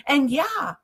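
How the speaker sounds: normal talking voice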